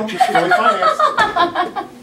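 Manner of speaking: high and slow, laughing through the words; cracking up. Real, not acted. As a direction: lose it completely mid-line. Actor breaks mid-line.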